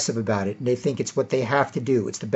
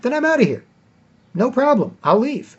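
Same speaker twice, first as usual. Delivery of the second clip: defiant exit; 9 semitones higher than usual. Real, not acted. Ready to leave party.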